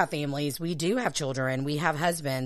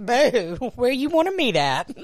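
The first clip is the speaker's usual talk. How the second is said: flirty voice; 5 semitones higher, 4 dB louder, slower than usual. Retold or imitated. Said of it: "I'm like".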